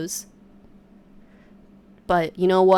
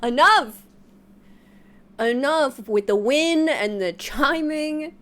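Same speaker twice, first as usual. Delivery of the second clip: exasperated outburst; 5 semitones higher than usual. Retold or imitated. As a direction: snap it out in frustration. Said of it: "I was like".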